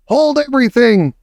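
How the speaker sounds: high shrieked order; shrieking. A narrator's reading. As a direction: stop them all with a shriek. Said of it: "Her shrieking voice echoed".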